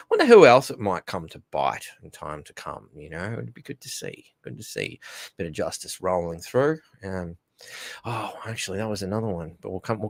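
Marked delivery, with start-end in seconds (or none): 4.4-5.0 softly whispered repeat; a breathy aside repeating the wish; whispered echo. Real, not acted. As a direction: echo it under your breath. Whispered echo of previous line.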